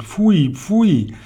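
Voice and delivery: high, exclaimed revulsion; high yuck of a revolted witch; disgusted. Acted.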